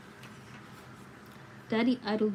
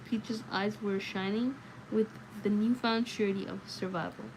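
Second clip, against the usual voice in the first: usual speaking voice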